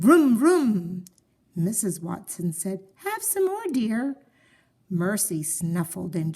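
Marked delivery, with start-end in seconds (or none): 0.0-1.0 revving engine vroom, loud and high; sleepy race car rev; car noise. Acted. Dreaming of driving fast car. 3.0-4.2 high, sing-song offer of more toast; high doting wife; motherly coaxing. Acted.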